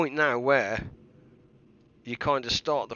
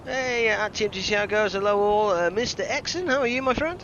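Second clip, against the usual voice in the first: high, loud streamer hello; cheery greeting; 9 semitones higher, 3 dB louder than usual. Acted. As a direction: greet the chat brightly.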